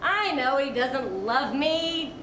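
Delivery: ordinary talk, nothing marked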